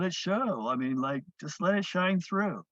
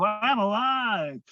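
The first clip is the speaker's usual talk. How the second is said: slow, drawn-out, higher affirmation; feeling alive; 5 semitones higher, 4 dB louder, much slower than usual. Shown as a demonstration. Teacher showing the powered breath's intention, slow and heartfelt. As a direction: savor each word like you mean it. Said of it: "So the feeling of".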